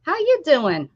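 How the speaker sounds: high bright hey buddy; sing-song hello to a friend; high greeting. Real, not acted. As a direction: greet like talking to a pet.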